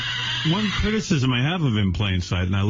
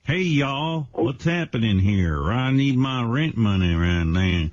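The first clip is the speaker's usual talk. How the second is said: slow, drawling Western landlord; Western accent; slower than usual. Pretend. Host as landlord demands rent money, Western accent. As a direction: stretch the words out in a cowboy drawl. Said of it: "The landlord should do that accent".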